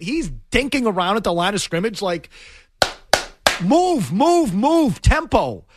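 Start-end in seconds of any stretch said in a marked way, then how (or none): 3.6-5.6 raised-pitch, frustrated urging; acted-out exasperated prodding, word by word; impatient urging. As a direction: urge a slow team to hurry up. Acting out urging the offense.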